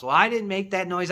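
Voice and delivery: usual speaking voice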